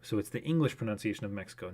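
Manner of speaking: ordinary talk, nothing marked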